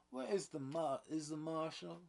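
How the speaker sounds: high, loud urgent call. A narrator's reading.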